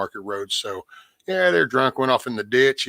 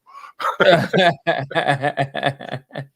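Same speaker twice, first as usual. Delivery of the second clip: loud laugh; 4 semitones higher, 6 dB louder than usual. Real, not acted. Laughing at own story.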